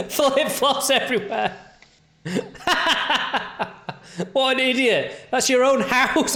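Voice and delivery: high laughing mockery. Real, not acted. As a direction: laugh at the idiot on screen. Laughing at clip.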